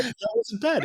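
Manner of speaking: high, laughing through the words. Real, not acted. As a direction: laugh it out, delighted. Laughing praise of Finnish intro.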